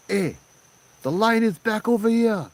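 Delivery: high, loud mock scolding; hammed-up scolding local uncle; gruff local scolding. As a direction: bark at the line cutters like an old local. Local man scolding tourists.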